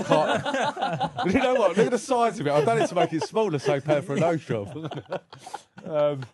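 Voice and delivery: cracking up at his own nose joke; laughing. Real, not acted. Guest laughing about his nose to the host.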